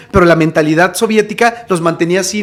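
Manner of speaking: ordinary talk, nothing marked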